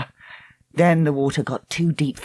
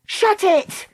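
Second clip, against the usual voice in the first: high cry to silence someone; sharp cry. A narrator's reading. Dakota silencing her screaming friend, sharp cry. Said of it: "Dakota cried".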